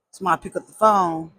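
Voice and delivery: ordinary talk, nothing marked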